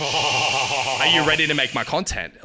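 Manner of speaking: slow, punchy hyped-up client voice; eager New York client. Retold or imitated. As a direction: sell it like a pushy broker. Voicing Mario's eager pitch.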